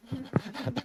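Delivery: a short breathy chuckle; quiet amused laugh; soft laugh. Real, not acted. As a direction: laugh lightly at the question. Laughs at own question.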